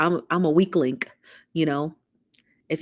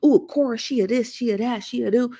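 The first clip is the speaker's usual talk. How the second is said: high catty gossip voice; mock a messy gossiper; 5 semitones higher than usual. Vlogger mimicking messy gossip she avoids. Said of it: "I'm not gonna be like".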